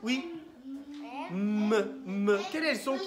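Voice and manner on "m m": "M, m" as two loud held hums; isolated M sound. Shown as a demonstration. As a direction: hum "M, m" on its own, firmly. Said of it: "Quelle est la lettre qui fait le son m". (son « m » isolé, tenu et fort)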